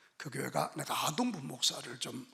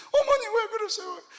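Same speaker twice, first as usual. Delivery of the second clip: pleading son; 7 dB louder than usual. Retold or imitated.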